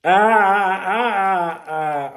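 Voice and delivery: high, loud pained ahs as the plaster pulls; real little yelps while peeling off a plaster. Real, not acted. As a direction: wince out loud at the sting. Pulling the plaster off his arm.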